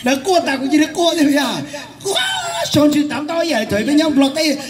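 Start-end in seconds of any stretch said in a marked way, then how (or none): 2.0-2.6 very high, soft, stretched wow; amazed wow. Retold or imitated. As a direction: marvel at it, half laughing. Amazed at the men crying.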